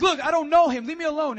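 high, very loud snapping denial; angry shout. Acted. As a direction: shout them off. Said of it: "he starts to curse".

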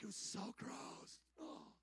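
hushed, breath-only recoil; mock disgust. Recalling first reactions to sushi, whispered mock disgust. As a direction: whisper it as if revolted.

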